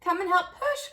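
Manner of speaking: crying out. A narrator's reading. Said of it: "cried the raccoon, the deer, and the goat".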